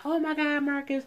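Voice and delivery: high, teary mock gasp; imitating her teary-eyed reaction. Woman told her man to propose, mock teary and high. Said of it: "she got all teary-eyed".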